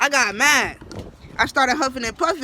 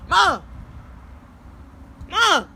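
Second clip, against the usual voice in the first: calling out; 8 semitones higher than usual. Retold or imitated. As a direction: yell for his mama. Calling out to mother.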